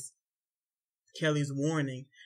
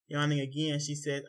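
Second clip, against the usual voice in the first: plain reading voice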